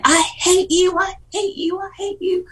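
pitched high, mock furious yell; retold angry yelling. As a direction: replay the rage, voice jumping up high. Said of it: "I started yelling and screaming at her".

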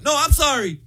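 high-pitched mock panic; flustered apology. Caught man apologizing back through the door, flustered.

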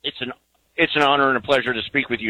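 ordinary talk

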